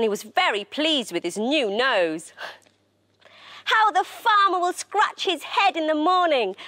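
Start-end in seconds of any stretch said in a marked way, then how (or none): 3.6-6.6 high, gleeful delivery; merry high voice, a touch of laughter; amused, laughing tone. A narrator's reading. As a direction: say it as if tickled by the joke. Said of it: "laughed Lily".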